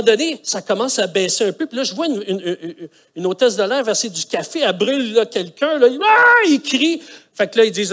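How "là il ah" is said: retold yelp. Passenger scalded by coffee.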